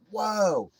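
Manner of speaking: mock amazed exclamation; acted astonishment. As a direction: react like a startled bystander. Onlookers' shocked reaction acted out.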